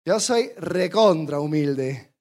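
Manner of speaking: a hint of a Peruvian accent, slow and self-satisfied; mock Peruvian accent. Pastor mocking a proud humble-brag in a light Peruvian accent. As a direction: lightly put on Peruvian accent, dragging the boast. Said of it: "Y más con ese acento".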